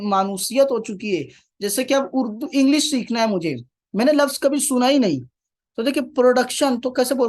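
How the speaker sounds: ordinary talk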